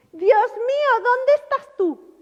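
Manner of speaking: high, loud, slow wail of despair; anguished cry. Retold or imitated. Preacher acts out believers crying to God, high and drawn out. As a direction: cry out as if abandoned.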